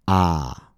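"ah" met de tong naar achteren, kaak omlaag; "ah" open en achterin; ah vowel. Shown as a demonstration. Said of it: "It sounds like this".

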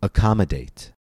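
Accommodate with a strong COM; stress before suffix. Shown as a demonstration. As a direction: punch the second syllable of Accommodate. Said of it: "the stress always falls two syllables before".

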